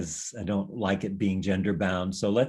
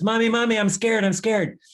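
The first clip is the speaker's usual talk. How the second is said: put-on high, loud, pleading little child; scared toddler; 12 semitones higher, 5 dB louder than usual. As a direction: cry out to mommy like a frightened small child. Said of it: "And the baby says".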